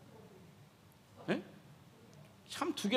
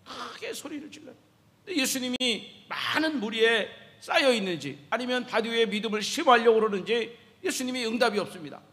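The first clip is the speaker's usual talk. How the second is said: ordinary talk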